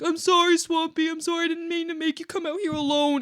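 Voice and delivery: high, whiny apology imitation; exaggerated whiny "sorry" voice; mock whining. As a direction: mimic a pleading crybaby coworker. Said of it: "You whiny".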